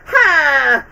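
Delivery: high loud burst; mock shout. Quoting the godmother's triumphant shout. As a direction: bark out a villain's ha. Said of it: "where she goes".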